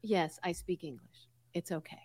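very soft, toned-down reply; barely voiced acted reassurance; quiet reassurance. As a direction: drop to a hushed, placating aside. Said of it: "I was like".

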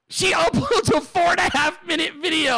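high, incredulous repeat through a laugh; can't believe it, pitched right up; laughing disbelief. Real, not acted. Host retelling the outrageous video upload, laughing disbelief.

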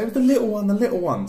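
mock childish pleading; upset little sister. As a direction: insist like a wailing little girl. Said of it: "She's like".

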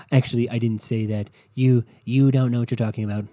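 loud, dismissive brush-off; dismissive Trump. Retold or imitated. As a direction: wave the reporter off loudly. Imitating Trump dismissing reporter.

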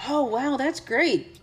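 high-pitched polite wow; imitated impressed worker. Restaurant worker praising her made-up career, mock impressed.

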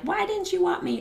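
very high, small pleading voice; plaintive child-like question. Retold or imitated. Grown child asks rejecting father why, high plaintive plea.

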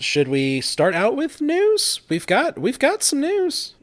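high, drawn-out sing-song lift; playful segment intro. Real, not acted. Host opening the news segment, playful high sing-song. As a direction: stretch it upward like a teasing jingle.

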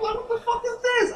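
squeaky high incredulous outcry; reliving the moment he saw the charges; retold shock. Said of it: "I'm like".

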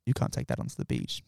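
mock the cop coldly repeating himself; deadpan cop repeat. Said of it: "He goes".

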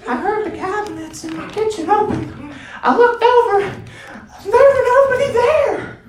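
put-on high, spooked old lady voice; old lady on ghost show. Old lady tells ghost show about her cabinets, high spooked voice. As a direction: play a frightened granny telling the camera. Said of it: "and she's like".

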